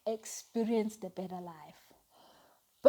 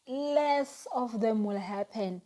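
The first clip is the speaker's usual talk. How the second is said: ordinary talk, nothing marked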